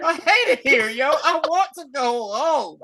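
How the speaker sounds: high, loud homesick wail. Retold or imitated. Podcaster mimicking a kid who hates it here, high mock wail. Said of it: "[name], when he was in the remake of Karate Kid".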